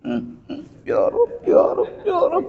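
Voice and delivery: whimper, then squeal out a put-on weeping prayer; pretend crying. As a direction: fake sobs into a high-pitched plea. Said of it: "Pura-pura nangis deh".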